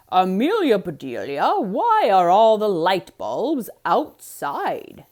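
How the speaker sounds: loud, slow, put-on voice of the puzzled husband; puzzled Mister Rogers. A narrator's reading. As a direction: drag out the question in disbelief. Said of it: "asked Mister Rogers".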